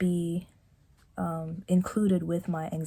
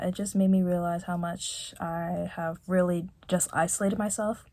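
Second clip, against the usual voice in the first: normal talking voice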